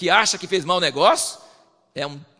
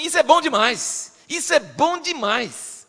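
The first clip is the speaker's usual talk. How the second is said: delighted; 9 semitones higher, 5 dB louder, slower than usual. Retold or imitated. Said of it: "só que tá falando assim".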